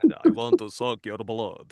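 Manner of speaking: a hint of a mock Dracula. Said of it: "the part of Dracula".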